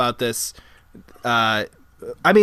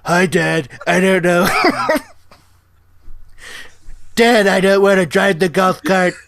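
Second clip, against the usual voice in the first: lightly put-on voice of a son whose voice is breaking; a hint of an awkward teen boy; teenage son voice. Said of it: "This is what he sounds like".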